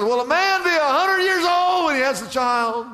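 high-pitched disbelieving question; acted incredulity. Abraham wondering at becoming a father so old, acted high incredulity. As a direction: exclaim it high, as if it were absurd.

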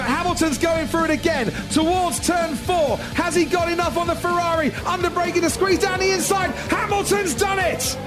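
excited commentary. Acted. Overtake commentary, shouting.

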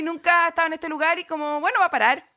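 acted wide-eyed musing; retold naive wonder. Retells own wonder as train nears.

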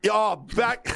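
usual speaking voice

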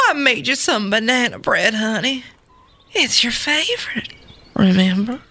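coaxing mother with a put-on Southern accent. Mother offers her son banana bread, Southern accent. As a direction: coax him in an amateur Southern accent. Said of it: "do this in a Southern accent".

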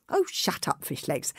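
impatient, dismissive snap. Acted. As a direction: brush him off impatiently. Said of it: "said Hiccup impatiently".

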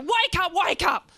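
high, loud repeated yell; urgent shouting. Acted. Spraying unconscious Shane with a hose, urgent shouting. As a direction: yell to bring someone round. Hosing unconscious friend awake.

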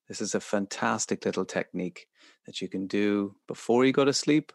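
usual speaking voice

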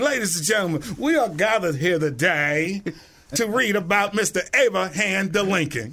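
hammed-up reverend announcing the reading; mock preacher voice. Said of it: "I'd be like".

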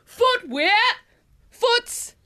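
slam poetry. Acted. Nervous host opens her slam poem, slam poetry. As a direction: declaim it in dramatic fragments. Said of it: "I've chosen to do slam poetry".

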